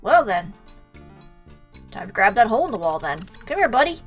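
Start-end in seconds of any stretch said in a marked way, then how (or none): none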